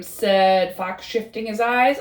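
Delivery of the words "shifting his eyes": usual speaking voice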